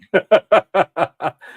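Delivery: loud, high staccato chuckle; real laugh bursting out loud and high; amused laugh. Real, not acted. Host cracking up at his own mean joke, loud laugh.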